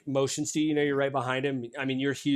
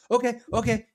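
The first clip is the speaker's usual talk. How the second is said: higher, flustered yes-sir voice; nervous young soldier; 9 semitones higher, 4 dB louder than usual. Acted. Hax flustered before his commanding officer, higher nervous voice. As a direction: stammer it out eager to leave.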